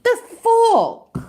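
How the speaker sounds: high, heated telling-off; real indignant rant; exasperated scolding. Real, not acted. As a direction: lay into a careless driver, rising in pitch. Scolding the driver in the clip.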